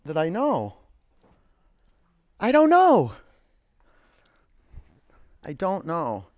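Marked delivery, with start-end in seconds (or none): 2.4-3.1 high squeal. Acted. Repeats phrase in sudden squeal.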